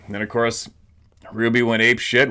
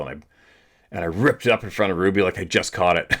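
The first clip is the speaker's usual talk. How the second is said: normal talking voice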